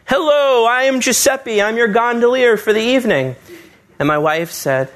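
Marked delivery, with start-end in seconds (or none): 0.0-3.3 put-on cheap Italian accent. Gondolier greeting the couple in a cheap Italian accent. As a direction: ham up a fake Italian accent like a stage gondolier. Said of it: "in a very cheap Italian accent".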